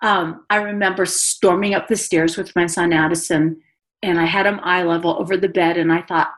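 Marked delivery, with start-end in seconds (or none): none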